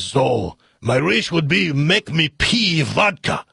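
slow, hammed-up Russian accent; grumpy comic Russian, stretched out; mixed-up Russian accent. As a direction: do a bad Russian accent, deliberately. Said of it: "this Russian accent's gonna sound like".

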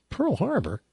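the Chinese guy confused by the accusation, mock bafflement; baffled question. Hammed-up disbelief. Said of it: "The Chinese guy says".

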